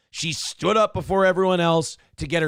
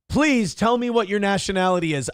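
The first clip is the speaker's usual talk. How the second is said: usual speaking voice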